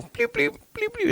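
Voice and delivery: very high-pitched electronic bleep imitation; car remote bleep. Trying to open the car door, imitated bleeps. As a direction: squeak out short key-fob bleeps. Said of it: "and I don't hear anything".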